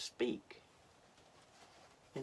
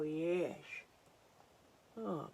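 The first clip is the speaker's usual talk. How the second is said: usual speaking voice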